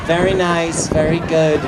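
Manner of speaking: mocking a bored, listless supporter; lightly voiced droning fan with no enthusiasm; a hint of sleepy, half-hearted cheering; low energy. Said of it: "they were so low energy".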